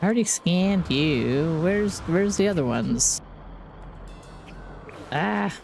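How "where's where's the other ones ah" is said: ordinary delivery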